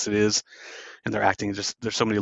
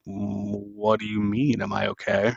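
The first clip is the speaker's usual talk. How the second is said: cold mother. Retold or imitated. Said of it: "she's like".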